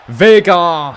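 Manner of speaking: loud, high yell at the player; sudden match-time holler; excited shout. Real, not acted. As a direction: shout the footballer's name in excitement. Shouting at player during game.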